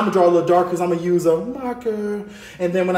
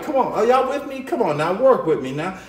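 ordinary talk, nothing marked

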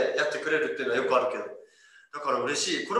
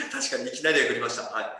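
laughing while agreeing; lower, laughing reply; amused agreement; 5 semitones lower than usual. Real, not acted. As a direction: chuckle through the words, voice dropping. Amused by the comment just read.

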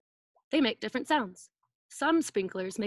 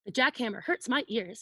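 ordinary talk, nothing marked

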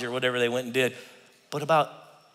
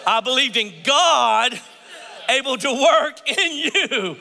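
high and louder, laughing through the words; real laughter while talking; 8 semitones higher, 3 dB louder than usual. Real, not acted. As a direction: chuckle through it.

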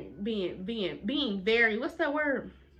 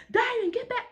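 teacher yelling; 14 semitones higher than usual. Retold or imitated. Teacher yelling her name.

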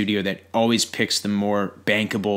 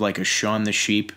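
ordinary talk

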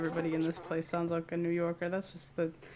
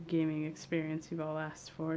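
usual speaking voice

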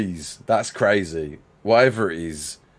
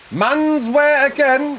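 mock announcer; 12 semitones higher, 3 dB louder, much slower than usual. Repeats the lift joke.

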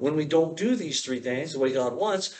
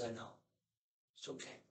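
comforting God; 5 semitones lower, 21 dB quieter than usual. Pretend. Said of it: "God holds you and says".

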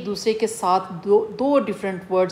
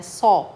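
"सॉ" held long and hit louder; long vowel; 5 dB louder than usual. Shown as a demonstration. Teacher demonstrating a long vowel to students, "सॉ" drawn out firmly. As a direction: lean on "सॉ" as the long syllable. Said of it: "long वॉल".

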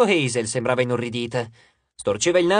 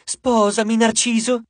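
high, loud fangirl cry; clamouring nymph, pitched up; shouting; 8 semitones higher, 4 dB louder, slower than usual. A narrator's reading. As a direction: shout it high, competing for his attention. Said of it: "gridò un'altra".

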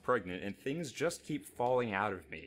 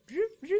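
high, loud cartoon zoop; a playful high sound effect; 6 dB louder than usual. Miming unscrewing with a sound.

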